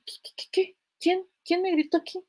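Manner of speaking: mock startled stammer, hushed then high; alterada. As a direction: act jumpy at being yelled at. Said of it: "me pongo tan alterada". (sobresalto fingido, voz alterada)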